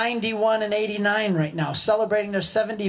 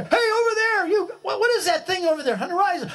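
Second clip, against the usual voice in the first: high shouted call across the water; 10 semitones higher than usual. Acted. Calling out to raft people across the water, laughing shout. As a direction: yell it out to the far raft, laughing. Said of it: "I yell to the other".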